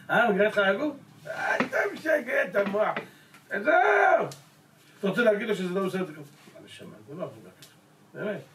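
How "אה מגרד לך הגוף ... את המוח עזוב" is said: mock scolding father. Father scolding a son looking for trouble, mock taunt.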